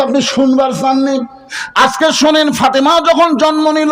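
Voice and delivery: high, declaimed retold speech; full-force preaching. Preacher voicing Khadija about Fatema's birth, full-force preaching. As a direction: declaim the quote, pitched high.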